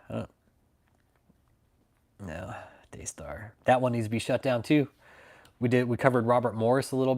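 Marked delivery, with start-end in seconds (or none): 0.1-3.5 quick hushed aside; whisper. Real, not acted. Streamer whispers a jab at Daystar. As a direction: drop to a breathy whisper.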